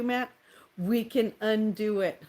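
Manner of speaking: ordinary talk, nothing marked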